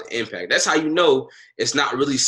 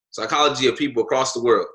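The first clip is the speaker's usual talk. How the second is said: ordinary talk, nothing marked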